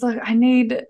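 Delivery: ordinary talk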